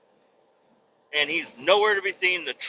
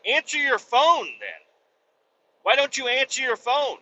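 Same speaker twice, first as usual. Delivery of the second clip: exasperated demand; 8 semitones higher than usual. Retold or imitated. Customer confronting mechanic over unanswered phone, exasperated.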